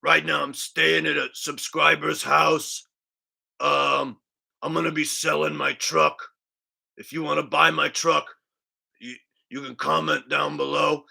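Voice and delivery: lightly voiced laid-back vlogger plug; a mild imitation of a vlogger reading his sponsor plug; Richie from Boston. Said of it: "updated Richie from Boston impression".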